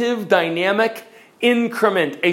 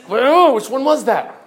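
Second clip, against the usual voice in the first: confused; 5 semitones higher, 4 dB louder than usual. Acted. Mock confused listener.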